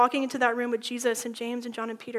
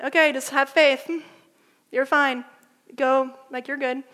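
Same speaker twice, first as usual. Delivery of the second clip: breezy, careless reassurance; dismissive brush-off; 6 dB louder than usual. Retold or imitated. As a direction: wave it off lightly, a bit louder. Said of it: "Jesus is not saying".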